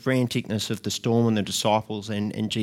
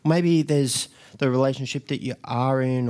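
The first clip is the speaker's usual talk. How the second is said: ordinary talk, nothing marked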